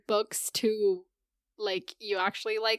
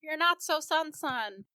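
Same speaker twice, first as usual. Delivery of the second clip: mock Cersei; 7 semitones higher than usual.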